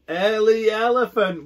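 high, loud teasing shout. Acted. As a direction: shout it out, laughing. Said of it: "we shout and laugh again".

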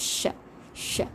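ஷ said softly, tongue lightly touching the palate; slow, gentle sha on each ஷ. Shown as a demonstration. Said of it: "மேல போய் லேசா".

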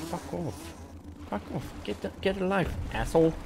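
very soft, irritated aside; muttered swearing. Real, not acted. Player muttering at pests mid-explanation, muttered swearing. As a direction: swear under your breath.

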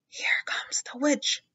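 slow scared whisper. A narrator's reading. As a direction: breathe it out slowly so the teacher won't hear. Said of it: "they whispered".

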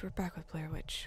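breathy whispered repeat of the line. Shown as a demonstration. Streamer whispers the game title to viewers. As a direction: whisper it like a secret. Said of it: "Did I say we're back with Blair Witch?".

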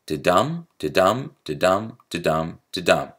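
"da-dum" five times, the DUM stressed each time; iambic beat demo. Shown as a demonstration. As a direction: chant five even iambs, weak "da", strong "dum". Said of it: "a sonnet goes like this".

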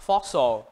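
"fossil" stressed on FOS, falling off at the end; first-syllable stress. Shown as a demonstration. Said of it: "Các bạn nhấn vào âm số một".

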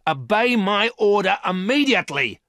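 high, loud furious shout; shouted order. A narrator's reading. Woman shouting orders at the disobedient robot, loud and high. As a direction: yell the command at the robot. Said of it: "shouted the woman".

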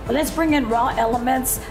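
normal talking voice

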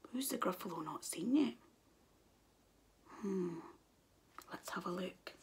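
soft fast aside whisper. Shown as a demonstration. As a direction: whisper to the child.

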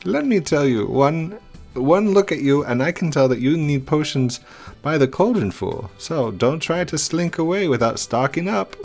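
high chirpy shopkeeper calling out; potion seller voice. Acted. Voices the potion shop lady.

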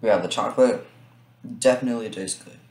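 speaking between bites; chewing while talking. Real, not acted. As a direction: mouth partly full of chocolate. Seen 3: Bites chocolate at -1, mouth working while unwrapping more.

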